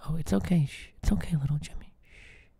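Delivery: soft hushed whisper; mock soothing whisper. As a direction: hush him like a scared child. Boss hushes the employee.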